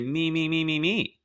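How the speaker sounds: mock child demanding. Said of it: "Or say".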